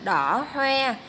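đỏ hoe with the oe of hoe said through rounded lips. Shown as a demonstration. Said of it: "mình đọc tròn môi".

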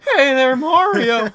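high, drawn-out holler; over-the-top squealed greeting; mock adoring shout. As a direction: belt it out in falsetto, very slowly. Bursts in mimicking the letter's greeting.

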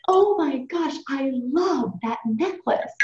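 high, drawn-out gush on a retold compliment; gushing admiration. Woman at church admires the speaker's necklace, gushing. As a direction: imitate an admirer gushing, pitch lifted.

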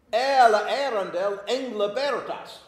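"Ela Arundel Engla Bertas" read aloud as an alliterative verse line; recited line. Shown as a demonstration. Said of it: "What is the alliterating sound?".

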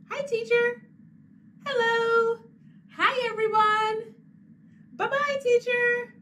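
put-on bright and bouncy to little kids; cheery greeting. Teacher greeting children in the book, cheerful.

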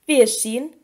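ordinary delivery

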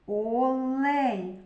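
Олень stretched slowly, lingering on the л; drawn-out Л. Shown as a demonstration. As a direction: hold the л in Олень to single it out.

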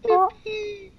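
very high, loud squeal; squeaky yippee. Pretend. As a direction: shriek it way up high. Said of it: "I'm losing my voice a little bit".

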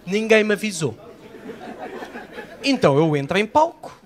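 ordinary talk

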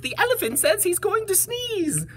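high-pitched bird warning; calling bird. Acted. Birds warn the bees of a sneeze, high calling voice. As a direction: pass on the alarm in a high bird voice. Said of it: "called the birds to the bees".

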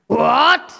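high, sharp outraged what; shocked king. Acted. Demon king hears Vishnu named, shocked outrage. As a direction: jolt of disbelief.

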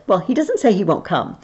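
usual speaking voice

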